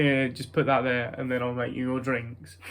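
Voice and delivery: usual speaking voice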